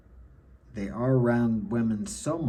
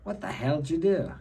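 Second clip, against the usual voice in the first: higher-pitched, exasperated demand, played half in jest; mock scolding; 5 semitones higher than usual. Father wanting to slap his crying son, mock scolding. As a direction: lightly act out an irritated father's telling-off. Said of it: "I wanna slap him and say".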